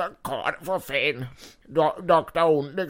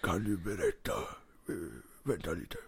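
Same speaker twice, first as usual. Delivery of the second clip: quiet villain; 8 semitones lower, 11 dB quieter than usual. Acted. GM voices villain.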